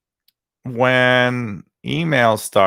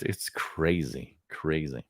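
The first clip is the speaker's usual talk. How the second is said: fading murmur; 5 semitones lower, 12 dB quieter, slower than usual. Real, not acted. Trailing musing on how crazy.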